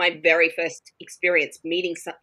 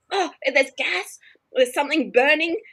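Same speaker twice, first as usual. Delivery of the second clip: imitating alarmed roommates; 9 semitones higher than usual. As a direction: act out the roommates' alarm about a leak. Roommates panicking about a leak.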